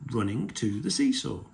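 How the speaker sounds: usual speaking voice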